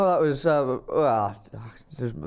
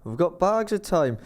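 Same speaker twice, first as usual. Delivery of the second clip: reassuring; 7 semitones higher than usual. Retold or imitated. Reassuring retold quote.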